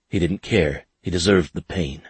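ordinary delivery, nothing marked

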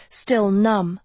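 ordinary delivery, nothing marked

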